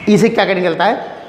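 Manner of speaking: usual speaking voice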